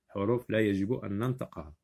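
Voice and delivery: ordinary delivery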